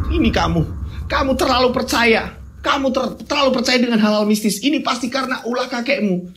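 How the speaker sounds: a wife nagging her husband; lightly voiced wife complaining about spoiled food. Wife blames the grandfather.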